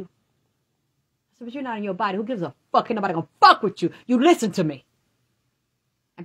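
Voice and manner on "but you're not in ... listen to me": rising to a loud, fast push; retold friend urging her to stand firm, swelling loud; forceful pep talk. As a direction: hype her up like a friend who won't let her back down. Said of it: "And he said".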